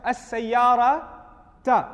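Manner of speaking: "As-sayyarata" drawn out very slowly, loud, with a falling end; warning emphasis. Shown as a demonstration. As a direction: stretch "As-sayyarata" as an urgent warning. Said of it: "You know what I'm gonna say?".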